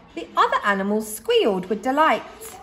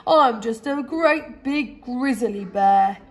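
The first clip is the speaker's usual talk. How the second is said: sad bear lament; slower than usual. A narrator's reading. Boris crying alone.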